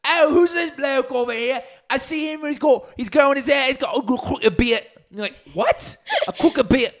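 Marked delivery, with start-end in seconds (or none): 0.0-4.8 put-on high, loud English accent; hammed-up English bloke spotting someone; exaggerated English accent, pitched way up; mock English accent. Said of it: "make up with the English accent". 5.5-6.9 put-on high, baffled English accent; squeaky English disbelief; mock English accent. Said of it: "make up with the English accent".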